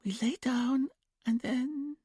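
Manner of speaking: put-on high, slow, whispered little-girl voice; childlike whisper. A narrator's reading. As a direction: answer dreamily like a small child in trance. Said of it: "Julia trailed off".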